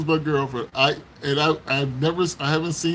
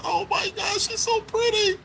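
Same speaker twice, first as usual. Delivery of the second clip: put-on smitten child. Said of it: "I was like".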